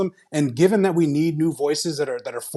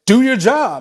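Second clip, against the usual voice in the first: raised, higher-pitched demand; imitated town hall heckler; 6 semitones higher, 5 dB louder, slower than usual. Quoting a town hall attendee at his senator, raised demand. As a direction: call it out like an angry constituent. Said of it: "never heard someone in that accent".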